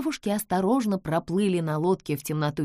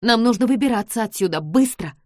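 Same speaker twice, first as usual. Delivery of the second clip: urgent, fast; 4 semitones higher, 6 dB louder than usual. Acted. Urgent escape.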